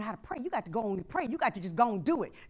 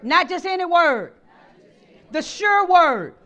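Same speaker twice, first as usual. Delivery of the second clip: loud, higher lead line for the church to repeat; call and response; 6 semitones higher, 17 dB louder than usual. Shown as a demonstration. Pastor leading the congregation in a loud chant. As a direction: call it out for them to echo.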